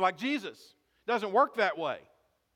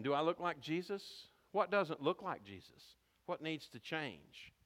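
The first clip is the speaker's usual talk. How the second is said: put-on soft question to kids; 4 semitones lower, 10 dB quieter than usual. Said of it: "Ask your kids this question".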